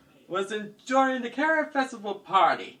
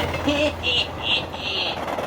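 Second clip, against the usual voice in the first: evil laugh; 3 semitones higher than usual. Acted. Villain laughs after pushing her.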